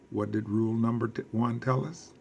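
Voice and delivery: ordinary talk, nothing marked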